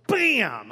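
imitated crash. Husband stumbling through the house at night, loud crash word. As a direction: punch it out like a sudden collision.

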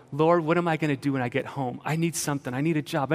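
normal talking voice

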